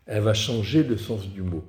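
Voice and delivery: ordinary talk, nothing marked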